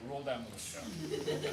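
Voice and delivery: low, soft aside under the breath; low mutter. Acted. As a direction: mumble it low and quiet. Hyena player mutters low aside.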